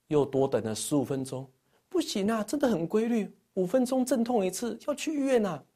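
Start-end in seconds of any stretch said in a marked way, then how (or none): 1.9-5.6 high-pitched imitation of insistent wife; wife's insistent plea. Wife insists to husband on going to hospital, high imitated urgency. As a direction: plead in a raised pitch, as a retold wife.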